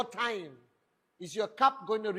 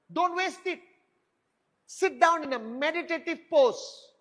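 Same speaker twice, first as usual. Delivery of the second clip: much higher and louder, pressing hard; emphatic high warning; 7 semitones higher, 5 dB louder than usual. Real, not acted. Coach scolding his team, high and loud. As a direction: jab it at them like a final order.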